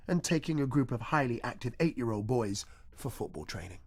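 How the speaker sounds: normal talking voice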